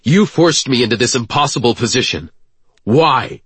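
furious accusation; angry. A narrator's reading. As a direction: confront him, pushing hard. Slams Castle against wall.

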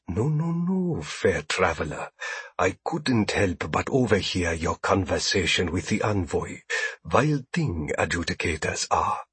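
soft fawning alien voice; smooth-talking alien merchant; ingratiating alien trader. A narrator's reading.

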